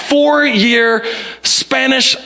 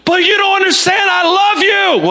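high-pitched desperate marital shout; frustrated spouse screaming, acted out; 12 semitones higher than usual. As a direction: yell it to force the message through. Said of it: "We're just screaming it at".

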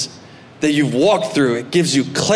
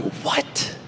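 breathless whispered what; stunned brother's whispered what; unvoiced shocked what; 9 semitones lower, 10 dB quieter than usual. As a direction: gasp out the question in disbelief. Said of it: "his brothers looking at each other and going".

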